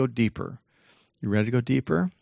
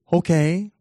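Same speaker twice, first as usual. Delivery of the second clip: high, perky little okay; bright-eyed yes-man, rising at the end; obliging brain; 6 semitones higher, 3 dB louder than usual. Acted. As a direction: answer as an eagerly obedient brain, pitched up. Brain obediently answers the speaker.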